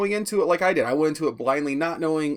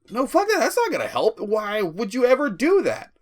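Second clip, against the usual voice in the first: higher-pitched mock exasperation; indignant protest; 6 semitones higher than usual. Acting out indignant viewer reaction.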